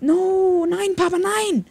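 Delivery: very high, loud hammed-up wail; gasp, then wail a pretend plea; mock despair. Mock despair at conceding in game.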